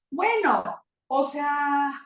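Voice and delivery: high, strained voice of someone hurting; acted hurt. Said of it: "puedo sentir feo".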